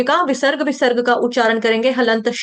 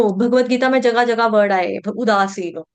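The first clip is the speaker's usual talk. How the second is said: ordinary talk, nothing marked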